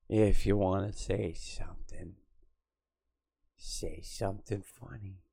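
hushed whisper, almost unvoiced; whispered aside. Real, not acted. Streamer scolding chat, whispered. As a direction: breathe it at the chat.

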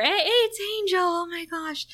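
high, gushing fan squeal; sudden high-pitched excitement; mock excited squeal. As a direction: gush like a starstruck fan. Mock excited recognition of Angelus.

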